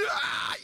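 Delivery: drawn-out mock shriek; acted cry of a panicked girl; imitated scream. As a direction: cut in with a pretend scream. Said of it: "Y gritaba".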